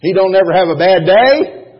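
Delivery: loud, high and rising, full force; preaching shout. Acted. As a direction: lift it to the congregation with a rising shout.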